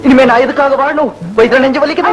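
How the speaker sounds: milk the pathos like a stage martyr; mock-tragic dying plea to his wife; hammed-up dying lament.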